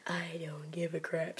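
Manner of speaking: whispered, slow grumble; sulky whisper. Acted. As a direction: mumble it under your breath. Sulky character muttering.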